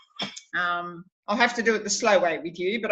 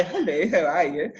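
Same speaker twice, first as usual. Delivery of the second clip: lower, posh "Hello, how are you?"; mock posh English; 5 semitones lower than usual. Shown as a demonstration. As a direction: put on a low Queen's English greeting. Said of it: "Some of us say".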